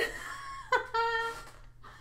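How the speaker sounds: squeaky high burst of laughter. Real, not acted. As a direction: break into a giggle.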